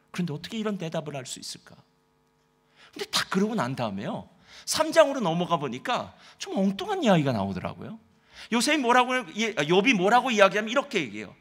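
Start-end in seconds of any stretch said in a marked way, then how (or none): none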